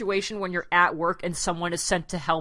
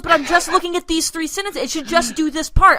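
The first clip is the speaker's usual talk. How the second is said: louder, a touch higher frustrated complaint; imitating frustrated coworker; 7 semitones higher, 7 dB louder than usual. Retelling coworker's printer complaint, louder mock frustration. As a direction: voice the confused coworker's indignation. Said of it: "She goes".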